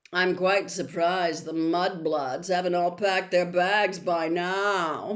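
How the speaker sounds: Malfoy mocking to Crabbe and Goyle; sneering Malfoy. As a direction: sneer for his cronies.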